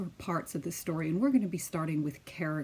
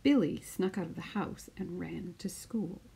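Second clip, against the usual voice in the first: put-on lively storytelling voice for children; picture book read-aloud; 4 semitones higher than usual. Teacher reading a picture book to her class, lively storytelling. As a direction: read it out like story time on the mat.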